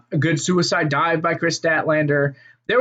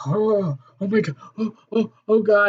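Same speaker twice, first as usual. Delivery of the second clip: imitated panic; 3 semitones higher than usual. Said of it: "Shawn Spears is just".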